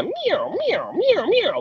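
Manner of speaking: high squeaky meow calls; imitated elk cow mew.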